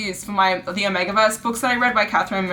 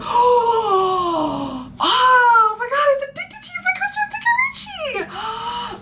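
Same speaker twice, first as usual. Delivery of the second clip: gasp, then a much higher thrilled squeal; excited squeal; 13 semitones higher, slower than usual. Real, not acted. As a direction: squeal with real delight at the gift. Thrilled at unwrapping a book.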